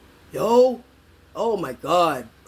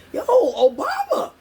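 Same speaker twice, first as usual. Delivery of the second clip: very high, slow laughing call; much higher laughing yell; laughing callout; 13 semitones higher, slower than usual. Real, not acted. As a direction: shout the name up high through a laugh. Calls out with a laugh.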